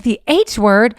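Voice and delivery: retold parent's startled question; questioning disbelief. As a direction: query it out loud, taken aback. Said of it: "I was like".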